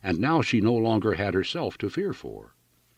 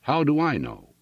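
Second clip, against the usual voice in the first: landlady's voice pitched much higher; shrill landlady; 7 semitones higher than usual. A narrator's reading. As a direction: toss it off, higher and dismissive. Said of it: "shrilled the other".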